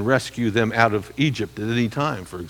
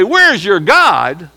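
taunting; 4 semitones higher, 6 dB louder, much slower than usual. Retold or imitated. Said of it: "they're taunting Israel".

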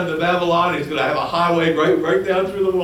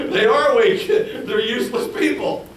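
higher, laughing through the words; 7 semitones higher, 3 dB louder than usual. Real, not acted. Preacher mocking the people's weakness with a laugh. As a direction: chuckle as you say it.

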